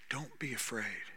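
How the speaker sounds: soft, low "Don't be afraid"; gentle reassurance. Acted. Holy Spirit comforting you, soft and low. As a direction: breathe it tenderly to the listener. Same words repeated softly.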